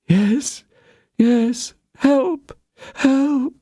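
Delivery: high, fading plea for help; a hint of a dying murmur. A narrator's reading. Dying Constance pleads for help, high but fading. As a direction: weakly beg for help. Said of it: "murmured Madame Bonacieux".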